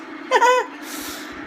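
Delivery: high, airy laugh at the mould; laughing at mould, a high airy giggle; breathy high giggle. Real, not acted.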